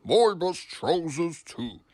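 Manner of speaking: loud, slow indignant boss; cross. A narrator's reading. Angry boss scolds.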